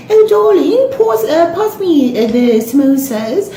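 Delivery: high, affected mock accent; fake posh accent. Woman at a function asking for samosas, fake accent. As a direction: put on a mincing fake accent. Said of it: "she's talking like that".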